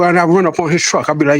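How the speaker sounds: ordinary delivery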